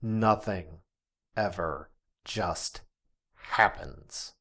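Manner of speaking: nothing ever just happens, slowed with pauses; spaced out for weight; slow emphasis. Acted. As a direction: lean hard on every word. Host drives home his point.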